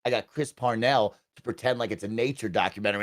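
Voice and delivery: ordinary talk, nothing marked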